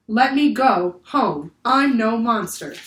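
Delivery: put-on slow, loud, in a child's own voice; repeating the creature. A narrator's reading. Annie repeats the octopus's words to the crew, slow and loud. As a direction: slowly relay his words.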